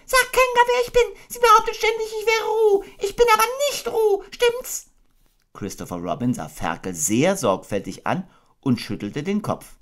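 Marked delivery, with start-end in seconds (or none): 0.1-4.9 very high, louder squeaky pleading; a desperate little pig; frantic Piglet. Acted. Piglet begging Christopher Robin to explain.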